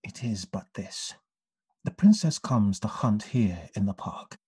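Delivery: hushed whisper of a secret. Acted. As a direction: whisper it so nobody hears. Berowne confides a secret errand.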